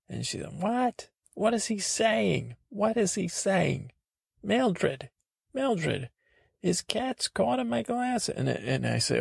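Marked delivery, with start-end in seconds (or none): 0.6-8.3 high-pitched mock voice of a confused woman; much higher, put-on woman's voice; baffled woman. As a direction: imitate a bewildered neighbour calling out for help. Acts out baffled woman calling Mildred.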